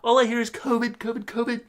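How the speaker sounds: mock Trump boasting about toilets; altered-voice Trump; Trump impression. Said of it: "my Trump impression".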